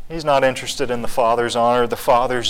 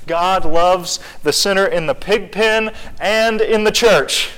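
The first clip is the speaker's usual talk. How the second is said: louder and higher, pressing every phrase; rising sermon fervor; preaching at full force; 5 semitones higher, 4 dB louder, slower than usual. Acted. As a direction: plead with the hypocrites to come in. Preaching climax to Pharisees.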